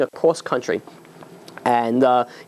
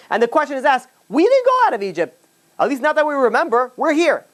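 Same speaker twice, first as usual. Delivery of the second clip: raised, ringing sermon voice; preaching; 8 semitones higher than usual. Acted. Rabbi to the congregation about Egypt, preaching high and full. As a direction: lift the question to the whole room.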